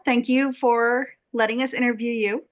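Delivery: normal talking voice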